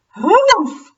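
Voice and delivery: high, loud huff; scoffing ghost. Acted. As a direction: huff in disdain.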